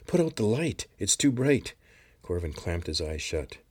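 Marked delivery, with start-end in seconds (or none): 0.1-1.7 loud, much higher pained cry. A narrator's reading. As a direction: shout it as the light stabs his eyes. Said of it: "he cried out in pain".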